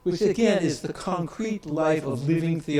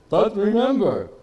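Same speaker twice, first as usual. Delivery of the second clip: put-on an elderly scholar's German accent, pressing the point on rooted; 4 dB louder than usual. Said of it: "he said in his very German accent".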